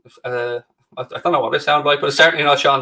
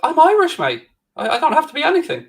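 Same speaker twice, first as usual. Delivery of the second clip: high indignant squeak; 13 semitones higher than usual. Pretend. Indignant reply in banter.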